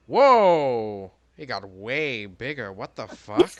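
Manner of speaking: loud, startled whoa; shocked. Acted. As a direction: jump back in shock. Character shocked, dagger grows.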